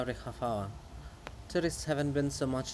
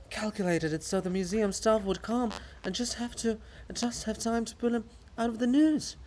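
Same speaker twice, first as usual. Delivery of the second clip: normal talking voice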